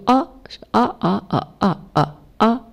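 "啊" repeated high and level; first-tone stress. Shown as a demonstration. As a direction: demonstrate "啊" like Mandarin first tone. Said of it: "第一声".

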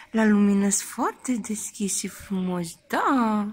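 loud, sing-song cooing to a newborn. Real, not acted. New mother cooing loudly to her hiccuping newborn.

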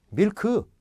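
밀크 as two full syllables, mil-keu; Korean-style 밀크, nothing reduced; every letter sounded. Acted. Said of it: "엠 아이 엘 케이가 다 들렸잖아요".

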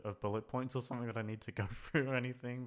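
ordinary delivery

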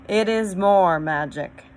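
loud, slightly grand proclamation; a touch of a majestic lion; great voice. A narrator's reading. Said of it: "said a great voice".

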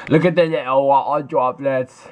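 exaggerated, drawn-out bad British accent; hammed-up fake British; gross British accent. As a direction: put on a really gross, slow British voice. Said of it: "really gross version of a British accent".

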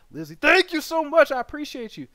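high, loud, beaming gratitude; excited thanks. Real, not acted. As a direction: shout your thanks at the screen. Thanking a viewer.

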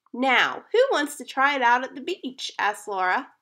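put-on reading to children. A narrator's reading. Teacher reading a kite story to her class, lively storytelling. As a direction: read it out to the class like a picture book.

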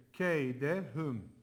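slow drawn-out "Keydehum", all thin; slow recited word. Shown as a demonstration. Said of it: "Bakın hepsi ince". (yavaş, ince okuma)

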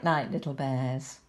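usual speaking voice

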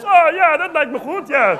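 high, loud mock Dutch PM in Dutch English; imitating Balkenende. Comic mimics the Dutch prime minister with a high, loud voice. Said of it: "when he says".

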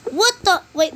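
usual speaking voice

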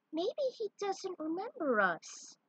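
high, slow, gentle princess voice. Acted. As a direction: Peach musing about the forgetful dinosaur. Peach wonders aloud.

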